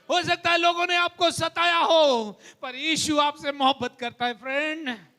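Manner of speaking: rising pulpit fervour; fervent preaching. Acted. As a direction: preach it to the church with conviction. Preaching love of God.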